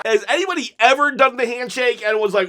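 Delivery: normal talking voice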